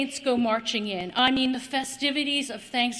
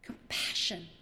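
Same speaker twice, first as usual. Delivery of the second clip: whispered; 6 semitones lower, 9 dB quieter than usual. Acted. Preacher to congregation, hushed whispered word. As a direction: breathe the word in a hush.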